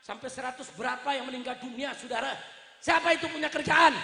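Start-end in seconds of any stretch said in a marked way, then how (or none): 2.8-3.9 high, loud rhetorical question; indignant raised-voice demand; accusing shout. Real, not acted. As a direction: hurl the question at the crowd.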